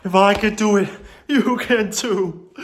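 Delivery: slow, earnest encouragement; motivational. Acted. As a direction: inspire the viewers slowly.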